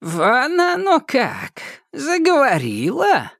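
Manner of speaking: high, slow astonished witch; old witch caught off guard; surprised old witch. Acted. Яга удивлена голосу. (удивлённая старая ведьма)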